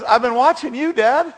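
put-on high, loud country twang as a little boy; hammed-up twangy kid, proud of Dad. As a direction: belt it out in corny country twang. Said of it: "You gotta say it with a twang".

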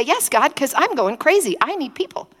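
high-pitched, mock-desperate complaint; exasperated protest. Telling God she can't take isolation, exasperated. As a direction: protest as if losing patience.

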